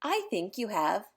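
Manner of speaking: usual speaking voice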